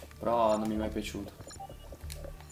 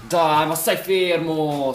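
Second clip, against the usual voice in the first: slow, loud exasperated yell; angry shout; 5 semitones higher, 11 dB louder, much slower than usual. Real, not acted. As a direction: drag out the angry command. Yelling at moving teammate.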